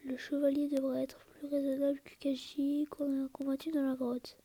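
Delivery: ordinary talk, nothing marked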